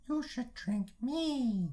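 high, slow, coaxing bottle voice; talking scotch voice. Pretend. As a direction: tempt like a talking drink.

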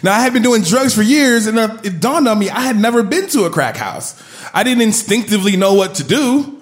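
usual speaking voice

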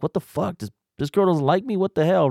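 high, rising exasperation; mock outrage at being rejected; exasperated disbelief. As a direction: pitch it up, baffled and hurt. Frustrated inner monologue.

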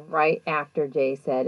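ordinary talk

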